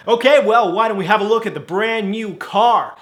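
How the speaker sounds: high, loud American manager; American accent. Acted. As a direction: pitch it up in mock American. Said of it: "'cause he's American".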